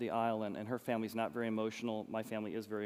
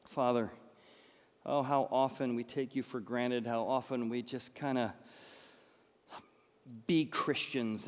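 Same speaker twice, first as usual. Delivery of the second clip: closing prayer. Acted. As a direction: pray it sincerely to God.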